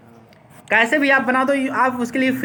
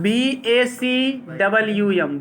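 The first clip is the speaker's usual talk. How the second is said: each letter spelt slowly, one by one; slow spelling out; much slower than usual. Shown as a demonstration. Teacher spelling the word slowly for the class. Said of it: "इसमें रहता है".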